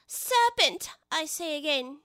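high accusing bird, starting loud then easing; pigeon, somewhat subdued. Acted. Pigeon repeating its charge to Alice, high then easing. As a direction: insist on it, then back off a little. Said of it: "in a more subdued tone".

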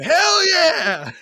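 high, loud whoop of joy; cheering shout. Retold or imitated. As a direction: yell it like a cheering crowd. Crowd cheering in a movie.

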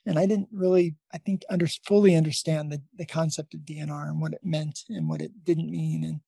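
ordinary talk, nothing marked

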